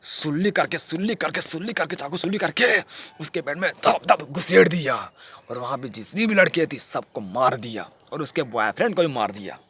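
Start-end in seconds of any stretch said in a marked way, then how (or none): 0.0-4.9 raised, chanting repetition building to hard blows; frenzied reliving. Acted. Killer relives the stabbing, frenzied and raised in pitch. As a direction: drive each repeat like a stab.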